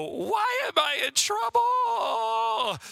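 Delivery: put-on high, slow whiny toddler wail; mock toddler meltdown, high and drawn out; imitating toddler wail. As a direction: wail it like a three-year-old who thinks she's in trouble. Toddler falls on floor wailing.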